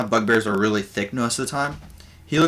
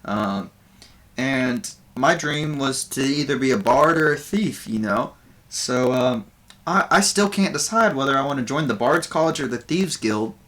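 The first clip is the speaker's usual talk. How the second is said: raised, louder comic bard voice; bard doing stand-up; 4 semitones higher, 3 dB louder than usual. Acted. Game master voices the bard Buvor greeting the audience, higher and louder. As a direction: Buvor working the crowd as a nervous comedian. Bard telling stage jokes.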